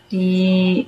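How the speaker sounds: ordinary delivery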